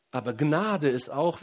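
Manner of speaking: ordinary delivery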